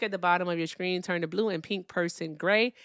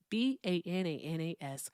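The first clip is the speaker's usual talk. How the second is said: B A N A N A S, each letter separate and quieter; spelled out letter by letter; 7 dB quieter than usual. Shown as a demonstration. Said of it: "spells out bananas".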